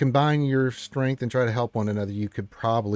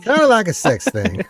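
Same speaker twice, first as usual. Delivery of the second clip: laughing out loud; high, loud laughing; 11 semitones higher, 10 dB louder than usual. Real, not acted. Laughing at motorboat joke.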